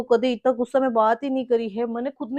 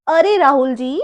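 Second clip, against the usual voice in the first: ordinary talk, nothing marked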